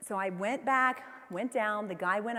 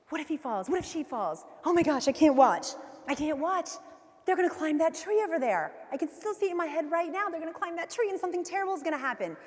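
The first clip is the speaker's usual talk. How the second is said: freaking out; 6 semitones higher than usual. Retold or imitated. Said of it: "I'm freaking out".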